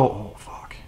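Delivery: breathless whispered "Oh, fuck"; whispered aside. Retold or imitated. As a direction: hush it like a private realization. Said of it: "you'd go".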